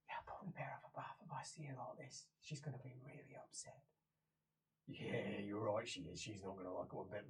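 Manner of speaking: low, very soft, half-whispered; hushed conferring. Acted. Game master voicing two companions conferring about the boss, low murmur. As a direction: murmur it privately under your breath.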